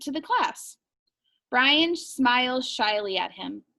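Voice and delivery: ordinary talk, nothing marked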